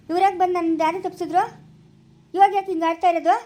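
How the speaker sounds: angry outburst. Acted. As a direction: lash out at being led astray. Family quarrel, feels misled.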